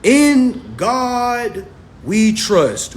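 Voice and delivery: slow, drawn-out proclamation; a high, slow solemn declaration; solemn slow slogan. Acted. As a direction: intone it like a motto, very slow. Opening slogan read slowly.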